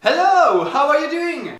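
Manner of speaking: over-eager server greeting. Acted. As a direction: greet the customer in a high, loud service voice. Acted English-speaking staff greeting customer. (accueil surjoué, aigu et fort)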